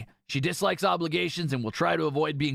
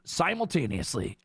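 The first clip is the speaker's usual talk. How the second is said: ordinary delivery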